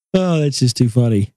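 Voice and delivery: ordinary delivery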